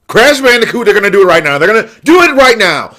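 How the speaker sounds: loud, high fan excitement; acting out a thrilled fan; excited outburst. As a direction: burst out in loud hype. Acting out excited fans' reaction.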